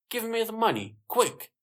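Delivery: high-pitched urgent order; urgent demand. A narrator's reading. Robber demands the money in story.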